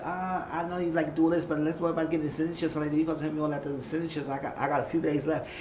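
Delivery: a hint of the wife, rushing her words; voicing her. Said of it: "She said".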